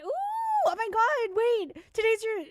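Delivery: thrilled realization mid-read; excited surprise. Real, not acted. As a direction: gasp it out as it clicks. Sudden realization reading chat.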